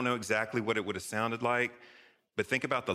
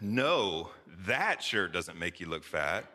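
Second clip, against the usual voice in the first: slow, pointed stress on "that"; 3 semitones lower, slower than usual. Shown as a demonstration. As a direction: lean hard on "that", implying other shirts do. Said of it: "emphasis matters".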